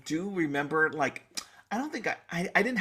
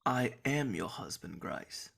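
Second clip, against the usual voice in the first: quiet hammed-up husband, name breathed out; the husband's claim, trailing to a breath; mock husband, softer; 4 semitones lower, 8 dB quieter, slower than usual. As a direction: play the husband, fading out. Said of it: "Say it".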